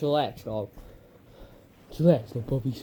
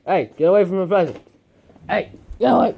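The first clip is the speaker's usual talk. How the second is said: louder, faster and higher shooing; 5 semitones higher, 7 dB louder, faster than usual. Real, not acted. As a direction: chase the puppy off the present. Shooing puppy from present.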